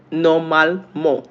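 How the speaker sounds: "normalement" said the French way, ending in "mo"; French pronunciation. Shown as a demonstration. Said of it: "How is it pronounced in French?".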